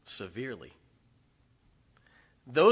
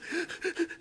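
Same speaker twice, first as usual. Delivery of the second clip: mock frightened gasp. Daughter reacting to stern tone.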